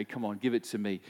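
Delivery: soft, low, tender request; quietly and kindly ask for it; soft gentle coaxing. Retold or imitated. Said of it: "he'd say".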